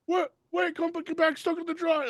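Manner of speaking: very high mock-panicked squeal; squeaky, hammed-up pleading; panicked husband. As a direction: imitate a desperate man calling her back. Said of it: "And he's like".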